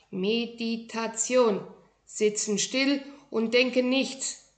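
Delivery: Meditation spelt out slowly, word by word; slow explaining. Acted. Little master explains meditation to a puzzled boy, slowly. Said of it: "erklärte er".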